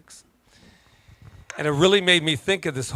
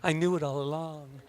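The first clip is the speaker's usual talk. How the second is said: smug softer aside; 8 dB quieter than usual. Retold or imitated. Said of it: "And everybody's like".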